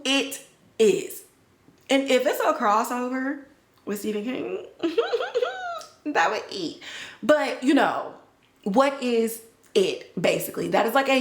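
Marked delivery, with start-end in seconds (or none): 4.7-5.8 high, quick real laugh; high laugh at her own joke. Real, not acted. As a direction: burst out laughing. Laughing at Stephen King idea.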